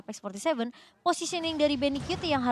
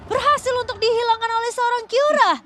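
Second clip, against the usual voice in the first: rising hype play-by-play, higher and louder; fighting game announcer; 9 semitones higher, 10 dB louder than usual. Acted. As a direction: call the big fight moment at full volume. Play-by-play call continues.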